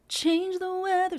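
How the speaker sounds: "Change" bounced in a slow sung line; bounce on change. Shown as a demonstration. Coach showing the bounce on change. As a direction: give "Change" a little lift. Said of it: "a little bit of a bounce on the word change".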